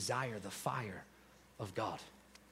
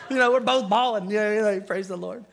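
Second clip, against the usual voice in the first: laughing recollection; 7 semitones higher, 8 dB louder than usual. Real, not acted. Laughing about bawling together.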